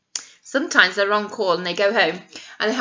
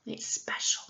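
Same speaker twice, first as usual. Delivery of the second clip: soft secret whisper; 13 dB quieter than usual. Acted. Teacher whispers to the nursery children, hushed.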